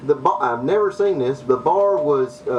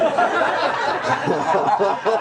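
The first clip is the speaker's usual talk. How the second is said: laughing; 6 semitones higher than usual. Real, not acted. Laughs after punchline.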